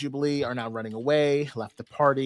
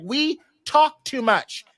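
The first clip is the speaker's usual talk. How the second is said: slow, higher-pitched, emphatic complaint; fed-up declaration; 6 semitones higher, slower than usual. Retold or imitated. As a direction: lay down each grievance slowly and dramatically. Voices fed-up Rogue.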